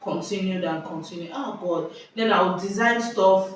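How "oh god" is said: normal talking voice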